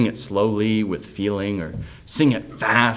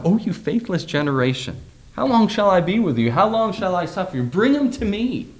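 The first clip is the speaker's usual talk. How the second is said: frustrated Jesus; 8 semitones higher than usual. Retold or imitated. Jesus scolding his faithless disciples, exasperated and raised. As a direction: let the frustration build as you scold the crowd. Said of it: "I honestly sense a tone of frustration in his voice".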